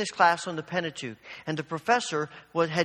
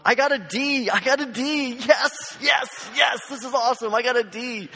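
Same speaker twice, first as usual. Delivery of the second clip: high, loud, giddy shouts of joy; gleeful celebration; 7 semitones higher, 5 dB louder than usual. Retold or imitated. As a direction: cheer like you just got a surprise good grade. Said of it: "dancing around the lobby ... going".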